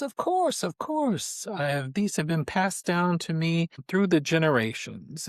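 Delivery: high-pitched, obliging fortune teller; Mama Megwin, fortune teller. Acted. Fortune teller handing her cards to the captain, high and obliging. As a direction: pitch it up high, then ease down.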